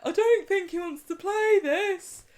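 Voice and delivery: put-on high, slow boyish voice; acting Jack. Friend voices Jack asking Abby to stop. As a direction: put on Jack's timid voice. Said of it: "Jack's like".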